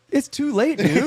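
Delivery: high, laughing exasperation; laughing disbelief. Retold or imitated. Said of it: "I'm looking at him like".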